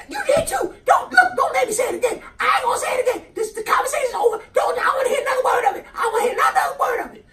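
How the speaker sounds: very high, shouting put-on woman; Rasputia voice. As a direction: bellow it in a huge squeaky voice. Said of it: "She's like".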